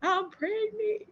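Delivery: high, slow tearful plea to mom; a hint of sobbing out the news; mock-tearful whimper, pitched high; retold crying confession. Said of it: "boo-hoo crying".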